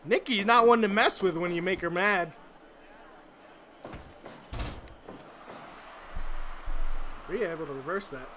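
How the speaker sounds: high, loud excited wrestling commentary; live play-by-play. Acted. Commentator calling the match for the viewers, excited play-by-play. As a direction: call every move like a ringside announcer.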